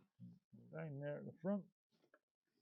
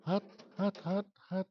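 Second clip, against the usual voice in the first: hot hot yelp; 7 semitones higher, 4 dB louder than usual. Real, not acted. Grabbing a hot part.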